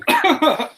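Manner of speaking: high, strained cough; hacking on swallowed water. Real, not acted. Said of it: "water went down the wrong hole".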